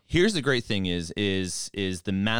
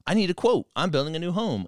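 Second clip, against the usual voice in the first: lightly voiced chirpy client, higher; mock client voice; 10 semitones higher, 3 dB louder than usual. A hint of a chirpy client emailing Ty for a quote. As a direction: play the friendly customer asking for a quote. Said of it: "an email from them saying".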